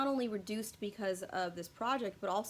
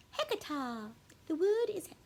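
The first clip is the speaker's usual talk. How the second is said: put-on failed British accent, pitched up; bad British accent; 8 semitones higher than usual. Vlogger botching the word in a bad British accent. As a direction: mangle a British accent on purpose. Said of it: "that is the worst English".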